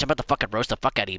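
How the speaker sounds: rushed mock threat. Rushed roast line.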